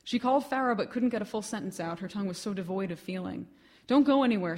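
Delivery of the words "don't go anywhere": loud and urgent, a sudden burst; urgent call. Acted. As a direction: shout it down the phone in alarm. Friend urgently on the phone.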